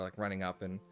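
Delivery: usual speaking voice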